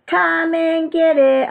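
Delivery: high, slow, drawn-out call; hollering. A narrator's reading. Froggy summons mother and father, hollering. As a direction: holler it long, like calling to dinner. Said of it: "hollered Froggy".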